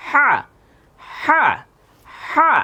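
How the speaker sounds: "হা" with a strong breathy h from the throat; throat letter drill. Shown as a demonstration. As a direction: model "হা" for repetition. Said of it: "আমার সাথে বলেন".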